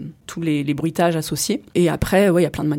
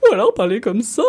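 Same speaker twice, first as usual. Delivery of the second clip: funny put-on voice; 5 semitones higher, 4 dB louder than usual. Shown as a demonstration. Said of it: "passer d'une voix à l'autre".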